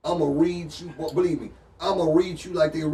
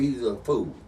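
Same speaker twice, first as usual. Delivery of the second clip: normal talking voice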